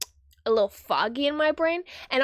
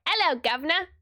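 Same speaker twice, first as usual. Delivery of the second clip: higher, hammed-up British accent; 5 semitones higher than usual. Shown as a demonstration. Reader finding the British accent again, hammed-up. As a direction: do a stock British "guv'nor". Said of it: "how to do a British accent".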